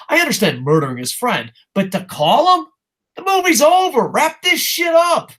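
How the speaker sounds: high-pitched shouting rant building to a yell; exasperated rant. Real, not acted. Streamer ranting at a movie villain's stupid choice, exasperated shouting.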